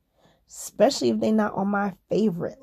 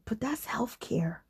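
whispered retort; hushed, conspiratorial aside; 3 semitones higher, 9 dB quieter than usual. Retold or imitated. Retorts to dad in story.

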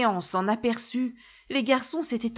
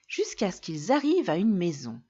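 plain reading voice

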